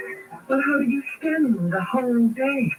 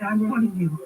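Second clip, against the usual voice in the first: normal talking voice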